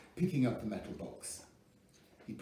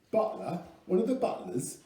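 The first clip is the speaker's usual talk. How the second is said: a rough attempt at a New Zealand drawl, pitched higher; lightly voiced New Zealand accent, drawn out; 9 semitones higher, 6 dB louder than usual. A narrator's reading. Hired bodyguard sizes up Butler in a New Zealand drawl. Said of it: "he said in a New Zealand drawl. That's the best I could do".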